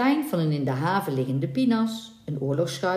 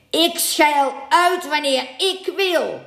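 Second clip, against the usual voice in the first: loud, high, slow angry snap; furious captain's snap; snapped; 10 semitones higher, 5 dB louder, slower than usual. A narrator's reading. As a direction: bite each word off at the mate. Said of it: "snauwde hij".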